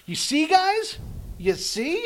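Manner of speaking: high, loud preachy insistence. Retold or imitated. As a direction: hammer the message home. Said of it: "but it's not like".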